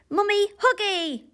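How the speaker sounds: put-on high, loud toddler wail; screaming baby. A narrator's reading. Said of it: "screamed the baby".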